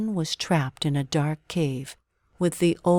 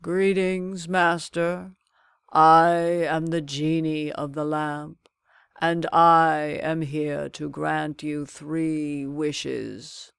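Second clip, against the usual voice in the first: slow, grand genie voice; slower than usual. A narrator's reading. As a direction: greet your new master with ceremony. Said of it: "a genie popped out ... exclaimed".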